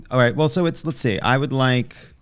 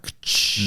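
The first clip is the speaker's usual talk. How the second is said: imitated game click; 6 dB quieter than usual. Shown as a demonstration. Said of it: "you know that noise".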